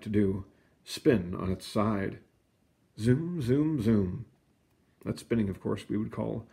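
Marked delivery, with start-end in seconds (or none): 3.0-4.2 playful zoom. A narrator's reading. Spinning planet sound effect.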